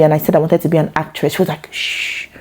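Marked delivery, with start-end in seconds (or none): 1.7-2.3 a sharp unvoiced shh; shushing. Mother silencing her daughter's talk of acting, urgent shh. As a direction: hush her quickly, like a worried mother. Mother hushing a child's dream.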